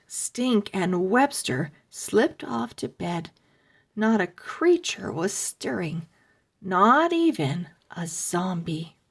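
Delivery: plain reading voice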